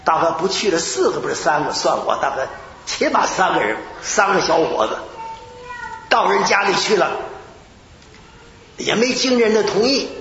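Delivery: ordinary talk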